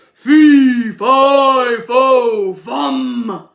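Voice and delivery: loud booming giant chant; thundering giant. Acted. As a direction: boom each word like a huge giant. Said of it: "who thundered".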